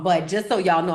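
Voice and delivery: usual speaking voice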